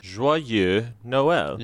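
slowly modelled French pronunciation; French accent. Shown as a demonstration. Host showing co-host the YouTube pronunciation, French accent. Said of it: "According to the man on YouTube".

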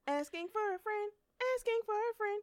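sung-out show segment call; hyped show segment call; segment jingle call. Acted. Announcing the segment name.

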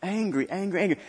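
usual speaking voice

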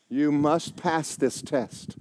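ordinary talk

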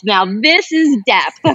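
impressed. Retold or imitated. Admiring a writer's story.

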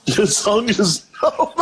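laughing disbelief, rising. Real, not acted. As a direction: ask it through laughter. Laughing at friend's complaint.

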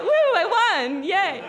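very high, loud hammed-up cheer through laughter; mock celebration. Tourist playing along with scammers, hammed-up laughing cheer. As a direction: overdo a fake victory whoop.

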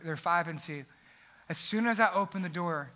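ordinary talk, nothing marked

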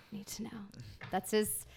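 soft conspiratorial whisper, like a secret. Pretend. Host lets listeners in on the guest's real name in a whisper. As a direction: breathe it like classified info.

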